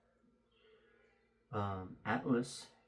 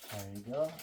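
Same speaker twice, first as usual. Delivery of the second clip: muffled speech behind a mask; through a face mask; 4 dB quieter than usual. Real, not acted. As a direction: talk through a cloth mask. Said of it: "Don't mind the mask".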